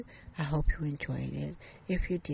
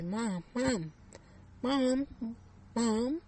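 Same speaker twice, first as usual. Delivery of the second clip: calling out; 7 semitones higher, 4 dB louder, much slower than usual. Acted. Daughter waking her mother.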